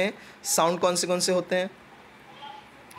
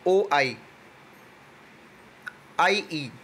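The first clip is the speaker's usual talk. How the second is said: ordinary talk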